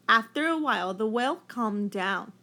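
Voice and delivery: plain reading voice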